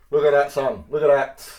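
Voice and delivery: loud, proud showing off; triumphant boast; proud shout. Real, not acted. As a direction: bellow it out to your son.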